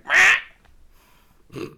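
very high, loud mock whine; squeaky annoyed meh. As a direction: yelp it way up high like an annoyed friend. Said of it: "you go really high pitched".

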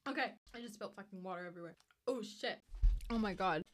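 ordinary talk, nothing marked

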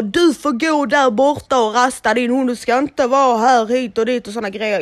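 put-on grumpy old man. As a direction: boom it out like a bossy old man. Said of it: "Han bara". (gnällig gammal gubbe som skäller)

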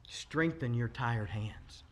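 almost whispered, slow quote; tender slow scripture; hushed scripture. Acted. Preacher quoting scripture softly.

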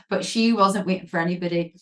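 normal talking voice

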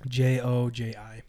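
slow, letter-by-letter spelling. Real, not acted. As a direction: spell it out slowly, each letter separate. Spells a name out on request.

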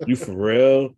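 incredulous question. Retold or imitated. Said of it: "Like, mad times in different ways".

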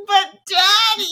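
put-on high, drawn-out child pleading; whiny kid voice. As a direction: beg like a spoiled kid. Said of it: "And they're like".

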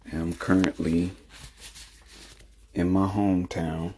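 soft, hushed voice so as not to wake someone; low talking. Real, not acted. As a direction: keep it low, talking quietly. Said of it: "kinda low talking right now 'cause I don't want to disturb her".

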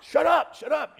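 loud, high, repeated order; exasperated shout for silence; scolding. Acted. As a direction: snap at him to shut up. Crowd silencing the beggar.

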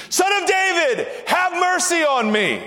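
very high-pitched, full shout; desperate, soaring cry for mercy. Acted. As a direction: yell the plea over the shushing. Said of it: "He shouted all the more".

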